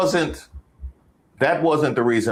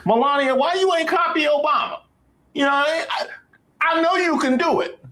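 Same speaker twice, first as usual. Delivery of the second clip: very high, squeaky falsetto; high-pitched mock pleading; 14 semitones higher than usual. Comedian asking Melania why she ain't copy Obama, in mock high-pitched pleading.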